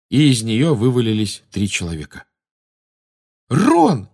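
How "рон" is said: high, loud cry of a name; hoarse exclamation. A narrator's reading. Harry spots his friend Ron, a hoarse cry. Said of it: "хрипло воскликнул Гарри".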